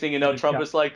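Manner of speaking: ordinary talk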